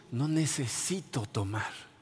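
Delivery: soft, slow and settled; calm quiet resolve. Retold or imitated. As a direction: say it as quiet peace. Cita calmada de libertad. (con calma, suave y lento)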